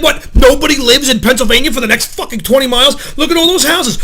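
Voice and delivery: reenacted loud, exasperated road-rage tirade; yelled rant. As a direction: fire off incredulous, indignant questions. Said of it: "I yelled and screamed".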